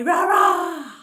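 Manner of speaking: big, high playful roar; lion roar. Pretend. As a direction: belt out a drawn-out roar for toddlers. Sung animal sounds for toddlers.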